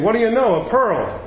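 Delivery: lightly acted cartoon surprise; surprised exclaim. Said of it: "and he exclaims".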